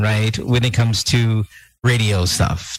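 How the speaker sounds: normal talking voice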